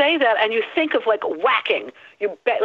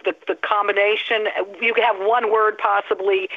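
ordinary talk, nothing marked

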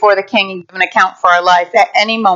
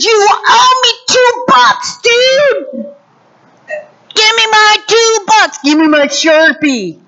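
much higher put-on bully voice; demanding servant; 9 semitones higher than usual. Servant choking his brother over two bucks, mock demanding. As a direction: shake him down in a high thug voice. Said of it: "took him by the neck and demanded".

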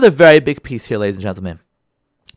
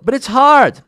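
whiny objection; 9 semitones higher, 5 dB louder, slower than usual. Acted. Listener objection acted.

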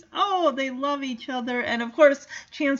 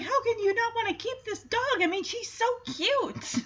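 high-pitched, cutesy mock pleading; pleading dog; 11 semitones higher than usual. [name] pleads to keep Delilah, high cutesy coaxing. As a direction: coax in a high, put-on voice.

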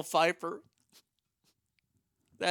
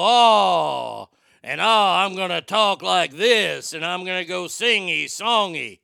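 much louder, slow, singsong Pacino; Al Pacino impression; 7 dB louder, slower than usual. Shown as a demonstration. Demonstrating how you do Al, louder singsong impression. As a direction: croon it big and singy like Al. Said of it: "when you do Al".

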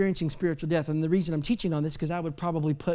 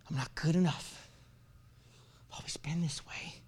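dejected self-condemnation; 7 dB quieter than usual. Acted. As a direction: confess it quietly, beaten down. Voices a condemned believer's inner thoughts.

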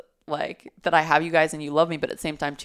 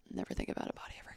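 trailing off into a hushed whisper; soft joking whisper; whispered aside; 15 dB quieter, much faster than usual. Real, not acted. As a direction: drop to a whisper as if confessing a silly wish. Joking wish to be a monk.

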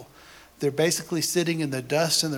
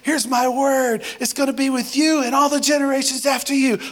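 much higher, louder, exultant proclaiming; joyful God; 9 semitones higher, 4 dB louder than usual. Pretend. God welcoming repentant people, high loud exultant proclamation. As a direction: burst out with God's eager welcome.

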